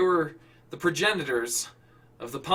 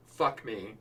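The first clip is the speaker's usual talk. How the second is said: plain reading voice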